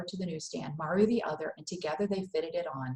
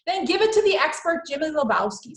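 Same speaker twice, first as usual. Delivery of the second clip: loud, much higher mocking mother; exasperated Mama; 9 semitones higher, 8 dB louder than usual. Mama scoffing at Mario's cricket knowledge, loud and high. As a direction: scoff at the boy's cricket facts.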